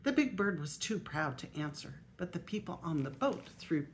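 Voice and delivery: lively storybook reading aloud; grandma reading a picture book aloud; reading to children. Acted. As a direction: read it out to the little grandchildren.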